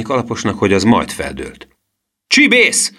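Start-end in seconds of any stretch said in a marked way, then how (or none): 2.3-2.9 high, loud bellowed insult; outraged shout; angry yell. A narrator's reading. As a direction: roar it at a pestering boy. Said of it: "ordította a kemény kalapos".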